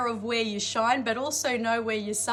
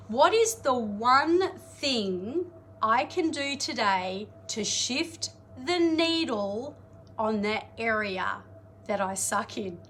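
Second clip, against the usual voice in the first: drawn-out, weighty slow question; slow, heavy self-questioning. Shown as a demonstration. Coach showing clients the daily question, slow and heavy. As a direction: spell each word out slowly like a grave confession. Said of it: "you don't have to say it like that".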